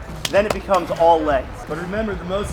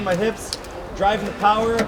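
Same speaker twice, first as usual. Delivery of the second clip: ordinary talk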